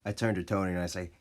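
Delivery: ordinary delivery, nothing marked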